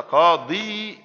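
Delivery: قاضي drawn out slowly as qaadii-yun; unreduced tanween form. Shown as a demonstration. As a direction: stretch قاضي, then add a separate "yun". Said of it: "في تنوين".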